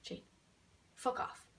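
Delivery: hushed, almost whispered dismissal; soft and understated, with a gap after the name; exaggeratedly quiet. Telling Jane to go away, exaggeratedly quiet. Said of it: "Maybe I didn't also say it like that either".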